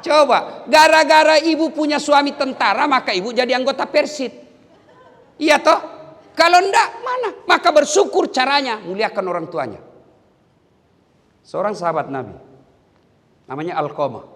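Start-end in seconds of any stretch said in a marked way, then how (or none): 0.1-9.8 high, loud sermon exhortation; forceful preaching. Real, not acted. Preacher telling army wives to be grateful, high and forceful. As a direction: drive the point home to the congregation.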